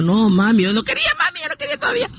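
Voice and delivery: high-pitched plea to mom; whiny retold protest; protesting daughter. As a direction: plead reluctantly, pitch leaping up.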